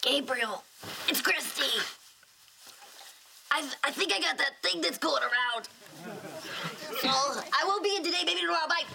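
thin, high put-on sick voice; fake sick voice. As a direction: pretend to be sick on the phone. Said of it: "Do that sick voice again".

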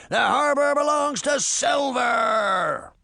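high, slow pirate bellow. A narrator's reading. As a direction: bellow it across the water to the ferry. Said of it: "one of the pirates bellowed".

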